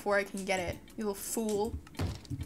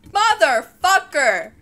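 rapid loud panicked no's, then a furious yell; frantic shouting; 3 semitones higher, 12 dB louder than usual. Real, not acted. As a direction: scream through the loss. Losing the fight in-game.

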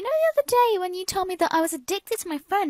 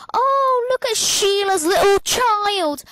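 high, gushing mock mum voice, louder; imitating mum gushing; 6 semitones higher, 6 dB louder, slower than usual. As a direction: parody mum cooing over a baby photo, high and loud. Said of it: "when you come over to me and saying".

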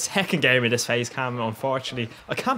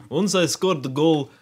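lightly put-on Russian accent, taunting opponent; a light mock Russian taunt; the Polish lad gloating in a mild Russian accent; 2 semitones higher than usual. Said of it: "That was very Russian".

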